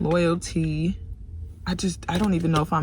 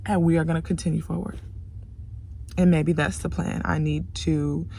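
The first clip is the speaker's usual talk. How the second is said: defeated murmur; 4 semitones lower, 7 dB quieter than usual. Real, not acted. Tired, deflated after rant.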